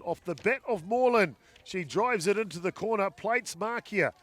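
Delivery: loud, high live sports call; excited play-by-play. Real, not acted. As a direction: call the hit with building energy.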